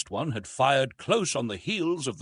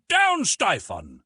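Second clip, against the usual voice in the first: high, slow, louder battle shout; yelled war cry; 8 semitones higher, 5 dB louder, slower than usual. A narrator's reading. Soldiers yelling defiance at the enemy, slow high shout. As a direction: stretch out a high rallying yell. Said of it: "There were a few yells of".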